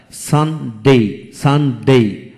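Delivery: Sunday drawn out in two separate beats; syllable by syllable. Shown as a demonstration. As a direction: pause between the halves of Sunday. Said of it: "যে ওয়ার্ডে দুটি syllable থাকে".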